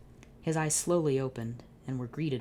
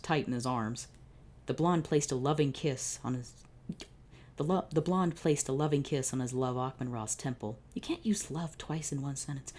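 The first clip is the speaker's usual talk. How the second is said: usual speaking voice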